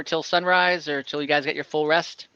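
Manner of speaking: normal talking voice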